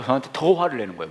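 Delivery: usual speaking voice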